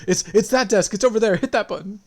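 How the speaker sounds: scared clerk. Retold or imitated. Assistant manager pointing robbers to the alarm, panicky. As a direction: blurt it out to please the robbers. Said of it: "It was like".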